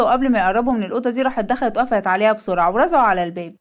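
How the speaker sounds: ordinary delivery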